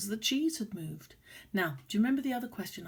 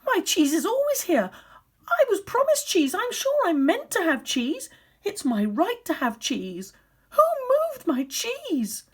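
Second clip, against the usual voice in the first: whiny upset humans; 10 semitones higher, 8 dB louder than usual. Acted. Teacher voicing the outraged humans for the class, high whiny complaint. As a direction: wail it like a spoilt child who lost his cheese. Humans protest their lost cheese.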